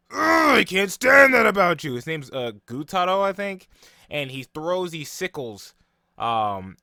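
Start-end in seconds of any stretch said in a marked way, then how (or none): 0.1-1.9 loud, high furious yell; angry outburst. Retold or imitated. Demon Gutaro screaming at Uzui, furious shout. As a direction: explode with envy at the rival.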